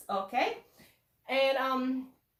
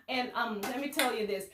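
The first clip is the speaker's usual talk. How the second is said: ordinary talk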